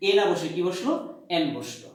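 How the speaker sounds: ordinary delivery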